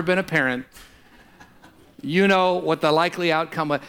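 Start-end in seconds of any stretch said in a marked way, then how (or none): none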